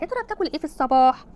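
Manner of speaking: ordinary talk, nothing marked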